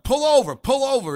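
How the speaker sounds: high, loud retold urgent call; urgent pull over. To his partner Tim in the patrol car, retold excited shout. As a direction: half-shout it like it just hit you.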